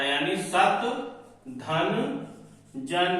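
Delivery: सत धन जन said as separate chunks with pauses; split into parts. Shown as a demonstration. Teacher breaking सज्जन into सत धन जन. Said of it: "ता लगना ही है".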